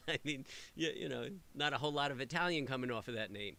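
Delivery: normal talking voice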